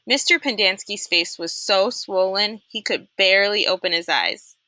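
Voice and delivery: usual speaking voice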